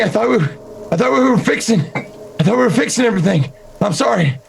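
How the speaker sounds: frantic, high pleading apology, rushing; panicked begging. Acted. Begging, rising panic in character.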